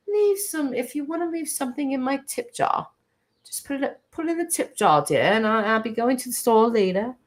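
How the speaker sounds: high, prim British host voice; River impression. Asking viewers for tips like the British YouTuber, posh impression. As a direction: put on a dainty, regal British accent. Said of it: "let me try to talk like him".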